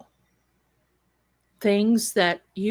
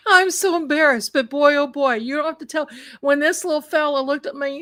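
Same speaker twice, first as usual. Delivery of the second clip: laughing embarrassed; 6 semitones higher, 3 dB louder, faster than usual. Real, not acted.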